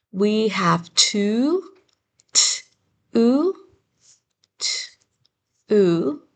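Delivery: to split as t- then oo; sounding out words. Shown as a demonstration. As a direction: stretch t- and oo apart. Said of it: "try writing".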